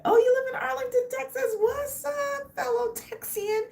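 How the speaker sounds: real excitement greeting a Texan viewer; excited greeting. Real, not acted. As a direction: squeal with joy at a fellow Texan.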